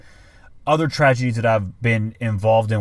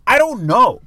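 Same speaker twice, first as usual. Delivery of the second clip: higher, louder frustrated "I don't know"; exasperated outburst; 12 semitones higher, 7 dB louder, slower than usual. Retold or imitated. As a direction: snap it out, fed up with the texts. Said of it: "and I'm getting mad".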